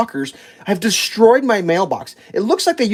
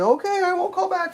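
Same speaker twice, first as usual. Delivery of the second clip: high, softer singsong voice; mock compliance; 13 semitones higher, 6 dB quieter than usual. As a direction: agree meekly in a high put-on pitch. Retelling meek compliance.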